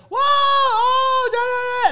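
very high, loud mocking whoop; mock loud reaction. Imitating the girls shouting at the movie, high and loud. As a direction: shriek it like the rowdy girls. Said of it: "they're like".

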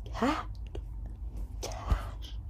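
breathy whispered repeat of the word; whispered echo. Retold or imitated. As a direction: whisper it like a temptation. Echoing the buyer's offer.

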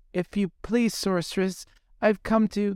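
put-on high, timid girlish plea; pleading young mermaid.

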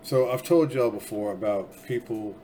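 ordinary talk

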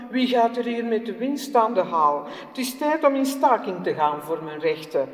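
loud, indignant union rant; angry workman. Acted. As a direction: rail against the rich, loudly. Workman rants about rights.

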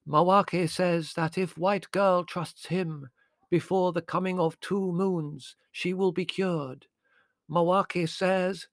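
high, drawn-out prophetic intoning; séance medium. A narrator's reading. Medium promising the girl a cure, trance voice.

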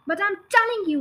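put-on high, insistent girl's shout; defiant pupil shouting at the headmistress. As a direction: shout back, refusing to yield. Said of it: "Matilda shouted".

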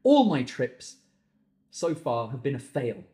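ordinary talk

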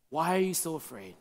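low, hushed question; softly spoken, pitched well down; quiet question. Acted. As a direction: ask it softly and low, with weight. Jesus waking, question voiced twice.

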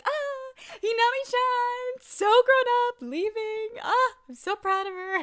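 high, loud gushing like a proud parent; proud doting voice. Retold or imitated. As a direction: coo over her like a proud dad. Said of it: "He's like".

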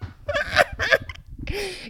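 high-pitched giggling fit; high squeal of laughter; real helpless laughter. Real, not acted. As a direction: crack up at your own story. Cracking up mid-story.

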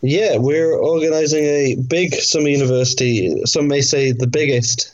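usual speaking voice

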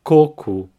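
coco, stress the first syllable. Shown as a demonstration. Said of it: "the emphasis is in the first syllable".

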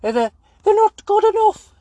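very high-pitched moaning Scouse caricature; high, halting football pundit's whinge; Roy Keane. Acted. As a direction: moan in a silly, squeaky Scouse voice. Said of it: "he's constantly moaning. He's like".

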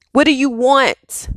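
normal talking voice

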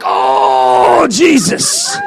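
loud laughing exclamation; exasperated exclaim. Retold or imitated. Reading his online comments, loud laughing exclamation. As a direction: cry out in half-laughing dismay. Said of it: "I'm like".

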